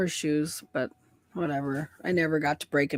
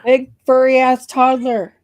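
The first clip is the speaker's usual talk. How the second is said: higher, louder, slow mock-scolding of the dog; teasing scold; 7 semitones higher, 6 dB louder, slower than usual. Real, not acted. Woman scolding the big dog as an overgrown baby, higher and louder.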